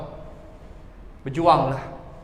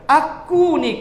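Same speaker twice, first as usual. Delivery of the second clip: ordinary delivery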